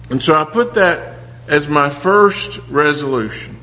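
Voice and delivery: ordinary delivery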